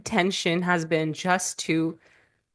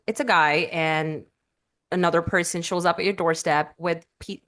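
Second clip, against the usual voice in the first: normal talking voice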